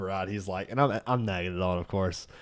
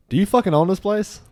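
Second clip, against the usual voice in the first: higher, louder mock angry snap at the bartender; angry retort; 8 semitones higher, 6 dB louder than usual. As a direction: throw it back at him, furious. Sean snaps at bartender, retold.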